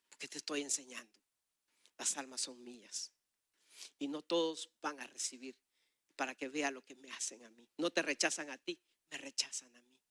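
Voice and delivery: low, soft, measured reply; calm divine answer. Retold or imitated. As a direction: answer quietly with settled authority. God's calm answer, retold.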